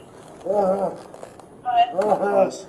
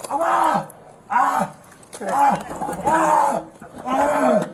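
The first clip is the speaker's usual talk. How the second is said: pained cries; 5 semitones higher, much slower than usual. Real, not acted. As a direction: cry out in pain again and again. Man in pain on ground.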